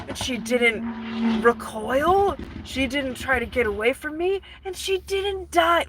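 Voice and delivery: hammed-up lovesick disbelief, rising in pitch; awestruck Phantom. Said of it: "and then he's like".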